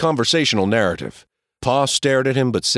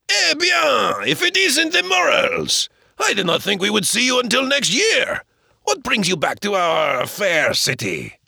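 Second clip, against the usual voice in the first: high, slow delighted greeting; hearty French shopkeeper; 10 semitones higher than usual. Acted. Laplace greeting the Morels, high and delighted. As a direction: call out in a high French-accented welcome.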